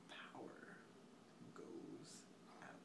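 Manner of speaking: very soft, broken up by long pauses; near-whisper, one word at a time; hushed dramatic repeat. Real, not acted. Storyteller repeating that the power goes out, hushed and halting.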